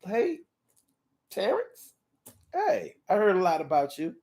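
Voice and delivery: a guarded mom greeting. Retold or imitated. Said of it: "your mom might give him a look like".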